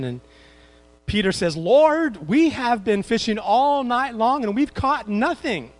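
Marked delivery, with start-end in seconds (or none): 1.6-5.7 weary protest. Retold or imitated. Peter protests after fishing all night.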